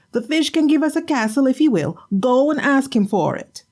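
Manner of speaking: high, nagging wife's voice; scolding wife. Acted. Wife scolding her husband for an empty catch.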